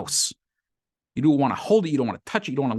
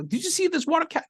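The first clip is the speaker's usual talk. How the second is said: high-pitched play-acted worried asking; mock frantic. Loser asks around on the street, mock frantic.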